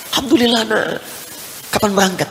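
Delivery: a high, light hint of a joyful mother; mother's happy voice. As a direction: lightly voice a mother glad at the news.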